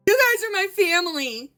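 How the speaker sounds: high, loud wail. Acted. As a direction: cry out that they're her family. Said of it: "she wailed".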